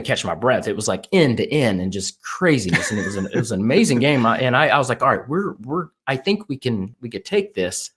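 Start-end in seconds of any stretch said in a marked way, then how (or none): none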